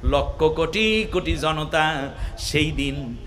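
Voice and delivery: half-sung preacher's storytelling; melodic sermon narration. Acted. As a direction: intone it gently, ending in a drawn-out chant. Preacher intones the account.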